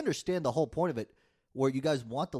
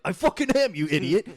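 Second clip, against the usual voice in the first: higher and louder, a shouted comeback; retold angry yell; 3 semitones higher, 9 dB louder than usual. Retelling her comeback to a dismissive Irish person, angry yell. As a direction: fire it back like you're insulted. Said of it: "I'm like".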